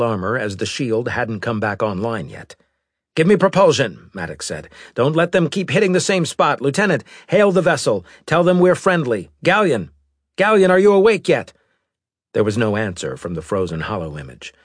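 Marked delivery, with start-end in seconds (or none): 3.1-11.5 high, loud, fast commands; urgent orders. Acted. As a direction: shout orders under fire. Captain barking orders in battle.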